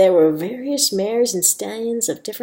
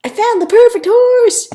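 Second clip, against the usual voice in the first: high, loud, excited holler; high-pitched triumphant call; 14 semitones higher, 11 dB louder than usual. A narrator's reading. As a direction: shout the news across the pens. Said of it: "Minnie called out".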